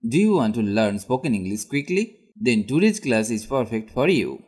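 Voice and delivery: normal talking voice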